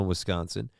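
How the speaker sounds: ordinary talk, nothing marked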